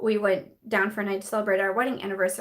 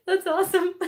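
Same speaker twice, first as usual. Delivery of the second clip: high, loud, teary with laughter; real giggly delight; laughing to tears; 8 semitones higher, 4 dB louder than usual. Real, not acted. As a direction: squeal it out while laughing. Still laughing at the comment.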